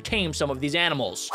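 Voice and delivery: ordinary talk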